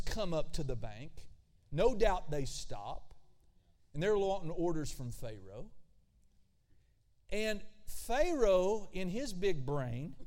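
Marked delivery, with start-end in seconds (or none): none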